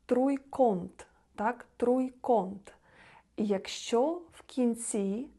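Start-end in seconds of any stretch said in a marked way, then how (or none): 0.1-2.7 труйконт with nasal on in the middle; Polish nasal ą. Shown as a demonstration. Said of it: "як он".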